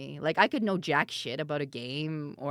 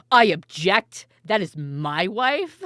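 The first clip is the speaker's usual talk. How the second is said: louder, slow dramatic objection; mock wedding objection; 3 semitones higher, 11 dB louder, slower than usual. Streamer pretending to object at Ko's wedding, loud and drawn-out. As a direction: announce it to the whole room. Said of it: "stand up in the middle and be like".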